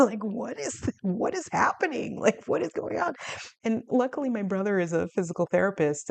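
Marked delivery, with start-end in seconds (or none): 0.1-3.1 incredulous. Retold or imitated. Recalling shock at doctor.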